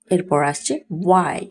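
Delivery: usual speaking voice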